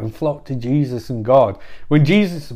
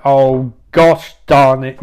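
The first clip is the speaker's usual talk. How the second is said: loud, drawn-out mild curse; mock frustration; 5 dB louder, slower than usual. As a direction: stretch each word in hammed-up annoyance. Jesus frustrated as everyone vanishes.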